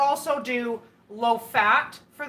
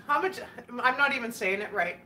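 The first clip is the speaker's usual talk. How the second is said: ordinary delivery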